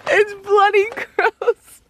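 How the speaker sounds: high, whiny groan of disgust; disgusted whine. Real, not acted. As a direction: squeal it out, totally grossed out. Handling a gross bag.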